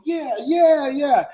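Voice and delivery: mock crowd cheer. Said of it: "they go".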